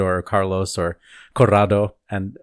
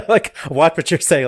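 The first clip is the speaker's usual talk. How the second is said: sassy, laughing; 7 semitones higher, 4 dB louder than usual. Retold or imitated. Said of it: "I love the sass of that".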